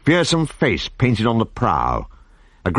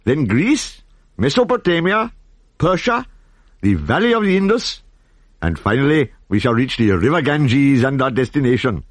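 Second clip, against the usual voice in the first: high, sweeping tour-guide delivery; high guide voice calling out each land; grand guide voice; 5 semitones higher than usual. Acted. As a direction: announce the route like a proud pilot. Guide narrating the flight route.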